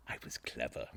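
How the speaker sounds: low, hushed and sly; sly whisper. Acted. Murderer confiding his cleverness in a sly whisper. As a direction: whisper it like a smug secret.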